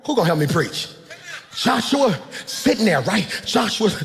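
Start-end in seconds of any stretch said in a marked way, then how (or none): none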